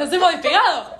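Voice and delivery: gleeful shout; excited. Real, not acted. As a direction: celebrate it with a laugh. Excited joke at stats.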